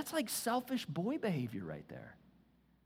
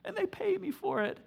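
soft, put-on complaining; mock office whiner; 4 dB quieter than usual. Preacher mocking men whining about desk jobs, soft and whiny. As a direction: whine about the cubicle, softer. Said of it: "we're sitting around going".

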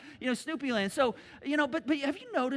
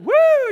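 high, loud coaster whoop; 19 dB louder than usual. Retold or imitated. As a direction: let out a thrilled woo. First dip of coaster.

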